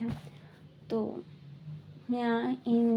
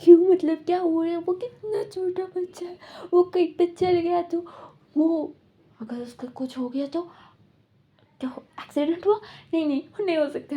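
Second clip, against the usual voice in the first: crying; 6 semitones higher than usual. Retold or imitated. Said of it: "वो ना भी रोने लगते".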